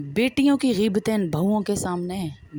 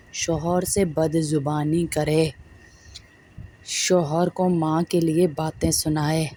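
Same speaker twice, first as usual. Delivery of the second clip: slow; 3 semitones lower, slower than usual. Real, not acted. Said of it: "slow sarcastic emphasis".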